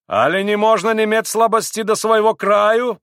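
high, loud indignant question; heated declaiming. A narrator's reading. Pan Vrublevsky defends his country, indignantly exclaiming. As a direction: proclaim it, boiling over. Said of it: "возгласил он".

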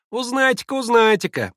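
very high, loud taunt; mocking boys. A narrator's reading. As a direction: jeer it in a child's voice. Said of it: "засмеялись мальчики". (высокая мальчишеская насмешка)

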